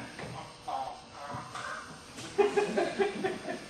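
imitated gurgling laugh in the throat; gobbling dog's throaty noises, like a woman; womanish throat sounds. [name] imitating the dog eating hamburgers, womanish throat sounds. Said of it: "making sounds in his throat like a woman".

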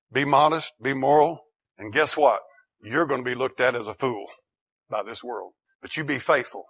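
usual speaking voice

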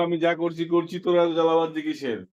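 soft quoted film line; quoted dialogue. Retold or imitated. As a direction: read the line quietly. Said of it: "ওকে dialogue বলে গেল".

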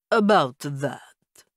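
put-on slow, guilty old-world vampire count; Count Chocula accent. A narrator's reading. Vampire father admitting fault to the narrator, in a slow guilty Count Chocula accent. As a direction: drag it out like a sheepish vampire count. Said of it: "a guilty tone to his Count Chocula accent".

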